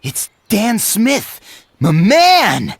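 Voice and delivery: loud, high, thrilled exclamation; excited greeting. Acted. Writer greeting Dan Smith, excited. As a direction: cry out in delight at seeing him.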